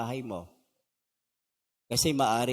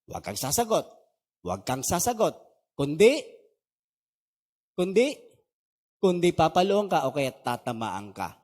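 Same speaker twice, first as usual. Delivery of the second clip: lightly acted strict Filipino dad, louder on "kundi"; a hint of a threatening father's warning; stern father; 4 dB louder than usual. Pastor mimics a typical Filipino father forbidding back-talk, mildly stern. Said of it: "pag tatay ganito".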